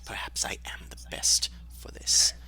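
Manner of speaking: hushed, unhurried whisper. Acted. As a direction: whisper it, as if sneaking.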